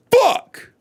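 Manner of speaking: high, sharp mock curse; imitating a petty tantrum; frustrated outburst. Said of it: "and going".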